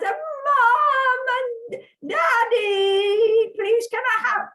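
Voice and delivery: high, loud, drawn-out call; unsubtle childish pleading. Retold or imitated. Child calling Mom and Daddy to beg for a puppy, loud pleading. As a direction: beg like an excited child yelling for the parents. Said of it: "in my normal subtle fashion".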